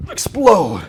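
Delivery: effortful one-word coaching cue; exertion call. Real, not acted. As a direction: push the word out with the lift. Calls out while lifting the weights.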